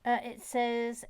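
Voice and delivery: ordinary talk, nothing marked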